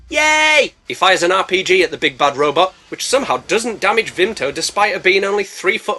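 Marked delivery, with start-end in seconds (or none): 0.1-0.7 high, loud cheer; a bright burst of joy. Real, not acted. Narrator cheering Rich T's arrival, excited.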